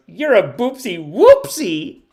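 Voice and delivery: high, slow, loud taunt; imitated child's comeback; little girl's comeback. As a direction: draw out the insult like a proud kid. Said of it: "And she goes".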